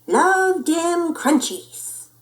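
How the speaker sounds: high, slow, savoring exclamation; relishing kitty boy. A narrator's reading. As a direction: stretch it out with delight. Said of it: "exclaimed the kitty boy".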